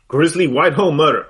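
loud, announced newspaper headline; news headline. Shown as a demonstration. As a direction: proclaim it like a news anchor. Reads newspaper headline aloud.

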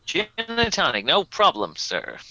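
chirpy American service voice; a hint of an eager American air hostess; American stewardess. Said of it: "she's American".

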